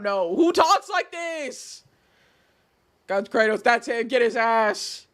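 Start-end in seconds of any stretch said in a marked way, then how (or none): 0.4-1.8 high, drawn-out incredulous question; appalled disbelief. Real, not acted. Reacting to the messages just read, exasperated disbelief. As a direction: stretch it out, baffled and exasperated. Baffled at what was read.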